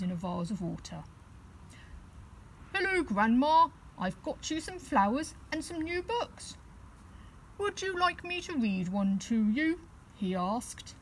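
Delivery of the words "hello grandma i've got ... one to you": little bear cub. Acted. Teddy greets "Grandma.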